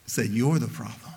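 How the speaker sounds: ordinary talk, nothing marked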